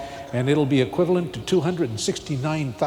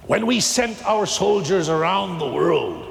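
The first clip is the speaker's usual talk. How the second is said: preaching at full force; 7 semitones higher than usual. Acted. Preacher stirring the congregation about soldiers, full-force preaching. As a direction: build it up like a preacher from the pulpit.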